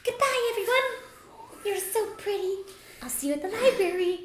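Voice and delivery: high airy fairy farewell; sing-song tiny fairy goodbye. Acted. Fairy Trisha leaving.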